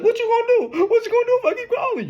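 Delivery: very high, fast, laughing mock voice; mocking her. Imitating the ex taunting him about calling again, high laughing mock voice. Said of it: "She like".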